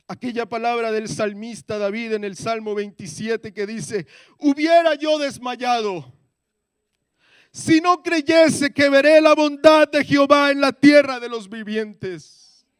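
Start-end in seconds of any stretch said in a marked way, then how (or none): 4.4-12.7 psalm proclaimed high, loud and slow; preaching at full force. Acted. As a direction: thunder the verse at the congregation. Proclama el salmo a toda voz. (proclamación del salmo a gritos, aguda, fuerte y lenta)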